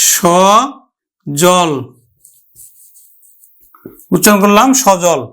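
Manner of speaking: সজল stretched slowly, open aw; teacher models সজল; drawn-out demo. Shown as a demonstration. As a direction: say সজল as shaw-jol. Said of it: "উচ্চারণ করব আমরা".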